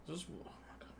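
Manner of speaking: stunned whispered disbelief; whispering. Real, not acted. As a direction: breathe it out in shock. Stunned by Wi-Fi dropping.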